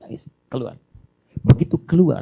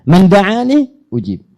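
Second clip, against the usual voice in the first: slow Arabic recitation; 6 semitones higher, 7 dB louder, much slower than usual. Shown as a demonstration. Preacher reciting hadith to congregation, slow chant. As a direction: draw out the Arabic slowly, chanting.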